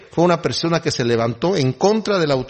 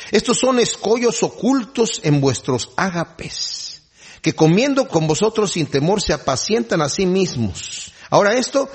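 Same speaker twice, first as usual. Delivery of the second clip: slow, higher solemn reading voice; a preacher slowly reads the verse to the congregation; scripture reading; 6 semitones higher than usual. Acted.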